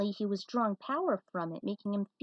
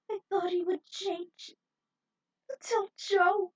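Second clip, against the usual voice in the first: distraught; 8 semitones higher than usual. Acted. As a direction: confess through heartbreak. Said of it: "was distraught".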